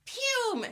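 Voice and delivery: high-pitched mouth-made blast; high nerdy "pum" effect; imitated attack noise. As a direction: fire off a single spell sound. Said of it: "So he goes".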